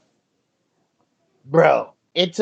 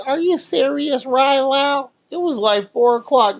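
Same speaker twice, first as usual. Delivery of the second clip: high incredulous complaint; mock exasperation, pitched high; disbelief; 11 semitones higher than usual. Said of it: "I'm like".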